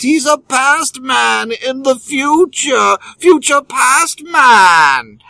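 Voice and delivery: slow, shouting English voice as a time traveller; English accent. Acted. Podcast host riffs on Doctor Who time travel in a hammed-up English accent. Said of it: "Don't know why the English accent".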